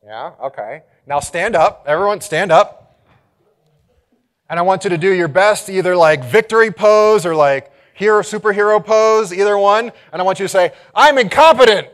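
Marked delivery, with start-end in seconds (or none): 1.1-10.7 loud, raised voice to the crowd; rally everyone to their feet; high-energy, much louder coaching; rousing call. Real, not acted. Rousing the audience to power pose. 10.9-11.9 high, very loud victory cry; booming, over-the-top proud declaration; triumphant shout. Shown as a demonstration. Models line in superhero pose.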